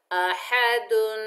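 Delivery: slow अहदुन, nasal nun held at the end; drawn-out अहदुन with ghunna; ahadun with tanween. Shown as a demonstration. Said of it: "इसे पढ़ा जाता है अहदुन".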